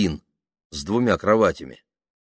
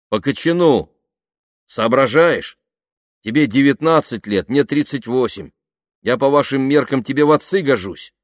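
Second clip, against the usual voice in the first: loud, high, indignant retort; exasperated scolding; 7 semitones higher, 7 dB louder than usual. Acted. Man of thirty-eight rebuffs girl of nineteen, exasperated scolding. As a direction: scold her at raised pitch and volume.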